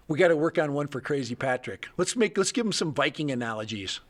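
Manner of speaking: usual speaking voice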